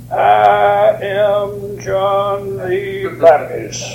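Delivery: very slow, drawn-out, self-important introduction on one held pitch; mock grand announcement. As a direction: stretch every word out pompously. Mock answer the Baptist never gave.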